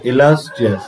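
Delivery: "illustrious" slowly, stretching the "lus"; drawn-out stressed LAS. Shown as a demonstration. Teacher modelling a word for students, stressed and stretched "lus" in "illustrious". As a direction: draw out "lus" in "illustrious" clearly. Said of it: "টেনে উচ্চারণ করতে হবে".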